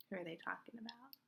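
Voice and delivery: hushed, quick whisper. A narrator's reading. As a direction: lean in and whisper the question. Aside to young listeners.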